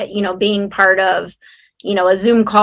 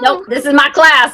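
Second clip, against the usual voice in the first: playful mock refusal; 7 semitones higher, 4 dB louder than usual. Host acting guest's refusal.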